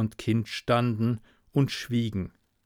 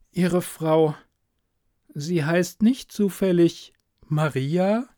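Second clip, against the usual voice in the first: slow, wavering, cracked voice; voice breaking; 6 semitones higher, slower than usual. A narrator's reading. Reporter asking the stranger, voice breaking. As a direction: let the voice crack as she asks. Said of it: "mit ungewohnt brüchiger Stimme".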